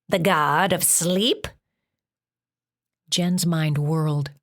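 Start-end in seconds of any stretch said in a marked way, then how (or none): 0.1-1.5 slow, stern, scratchy monster voice. A narrator's reading. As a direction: press her coldly like a scratchy-voiced beast. Monster questioning Jen.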